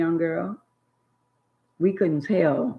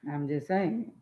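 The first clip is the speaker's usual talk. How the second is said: ordinary talk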